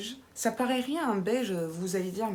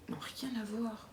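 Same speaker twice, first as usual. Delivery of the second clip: breathy whispered aside; whispered aside to viewers; 9 dB quieter than usual. Real, not acted. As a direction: drop to a whisper.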